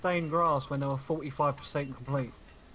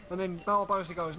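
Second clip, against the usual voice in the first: usual speaking voice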